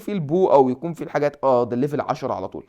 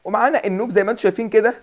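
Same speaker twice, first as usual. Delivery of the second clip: excited announcement; 4 semitones higher, 6 dB louder than usual. Real, not acted. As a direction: show off the first pet proudly. First tamed turtle shown. (بفخر وحماس)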